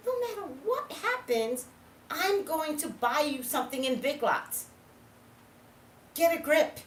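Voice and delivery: exasperated. Retold or imitated. Losing patience with sister, building up.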